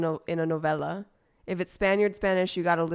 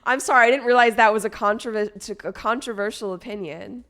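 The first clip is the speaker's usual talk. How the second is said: usual speaking voice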